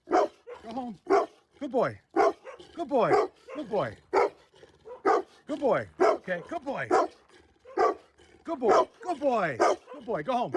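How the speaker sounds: gentle high-pitched reassurance to a stray; soothing dog talk. Real, not acted. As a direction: coo at the dog to settle it.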